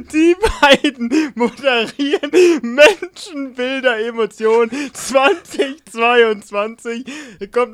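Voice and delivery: high, loud and slow through laughter; laughing. Real, not acted. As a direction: laugh while announcing it. Laughing disbelief at own news.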